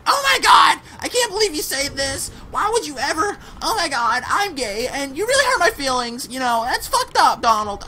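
high, loud whiny mock outrage; hammed-up offended viewer, high and shrill; mocking offended person. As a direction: parody someone shrieking that they're hurt. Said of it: "without someone being like".

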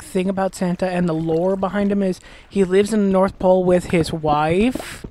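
normal talking voice

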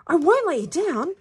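put-on high, loud boyish promise; crying out. A narrator's reading. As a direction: cry it out eagerly, pitched high. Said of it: "cried Bruno".

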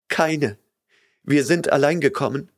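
put-on terrified young woman begging; tearful frightened girl. A narrator's reading. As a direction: beg him not to hurt you. Girl's voice.

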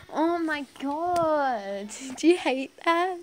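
loud, high, drawn-out exclamation; real exasperation, stretched out high; exasperated outburst. Real, not acted. Outburst at phone interruption.

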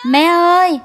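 loud, high anxious calls; calling out. Acted. Daughter-in-law calling loudly for her lost mother-in-law. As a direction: call out across the distance searching.

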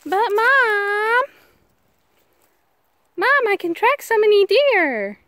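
put-on slow, high kid-like protest; whiny dog voice. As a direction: play the dog begging its mom. Voicing the dog's whine.

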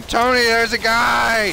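high, loud, drawn-out panicked yell; panic scream. Retold or imitated. Player retelling a sudden scare in the game, panic scream. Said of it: "I panic scream".

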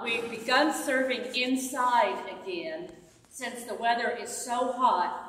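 ordinary delivery

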